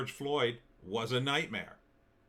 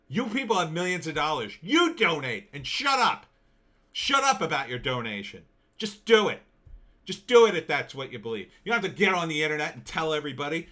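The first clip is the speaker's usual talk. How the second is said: real angry rant; 6 dB louder than usual. Real, not acted. Ranting at rich Hollywood celebrities, real loud angry shouting. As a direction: yell at them to shut up and just donate, getting more furious. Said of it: "I'm sorry I got a little upset".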